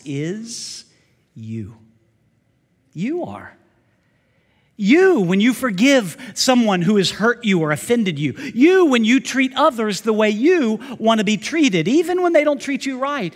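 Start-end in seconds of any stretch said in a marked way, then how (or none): 4.8-13.3 rising, louder preacher emphasis; fervent pulpit build; preaching loud. Real, not acted. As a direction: drive the point home with force.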